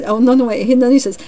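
ordinary delivery

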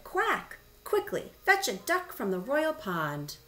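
high-pitched excited order; storybook servant in a flurry; urgent servant. A narrator's reading. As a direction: send someone rushing off.

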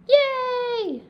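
high, loud little cheer; child's bright whoop. Acted. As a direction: cheer like a thrilled kid. Cheer after getting home.

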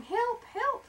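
high yelled cry for help; a tiny spider yelling help. Acted. Spider stuck in sink yells high for help. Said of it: "another voice yelling".

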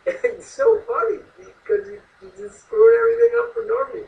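high-pitched laughing talk. Real, not acted. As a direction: laugh through the words. Laughing hard at Norma.

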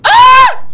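very high, loud shriek; short piercing scream of fright; startled scream. Real, not acted. Player jumped by the creature, startled scream.

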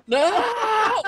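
laughing no. Real, not acted. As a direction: squeal it through a laugh. Laughing protest.

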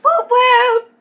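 excited whooping. Real, not acted. Excited intro cheer.

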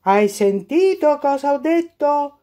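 put-on dad shouting at his inattentive girl; dad's yell. A narrator's reading. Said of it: "urlava il papà".

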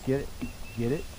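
ordinary delivery

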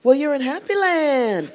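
high, slow, sweetly welcoming; cheery locals. Retold or imitated. Happy Land people welcoming Mister Happy, high and slow. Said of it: "And they were like".

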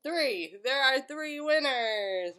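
slow, emphatic counting; teaching to count. Shown as a demonstration. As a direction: spell it out slowly for a child. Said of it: "Count with me".

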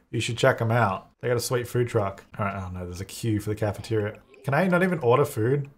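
ordinary delivery

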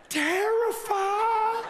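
high, very slow drawn-out word; terrified. Shown as a demonstration. Preacher acting out the kid's fear, high drawn-out word. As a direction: stretch it out in fear. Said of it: "He kinda looks like this".

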